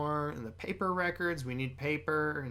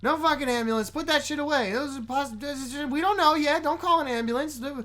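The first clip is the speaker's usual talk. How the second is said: high, agitated argument in a crisis; acted panic; 8 semitones higher, 5 dB louder than usual. Podcaster acting out characters arguing over an ambulance, panicked. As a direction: play flustered friends getting more frantic, pitch climbing.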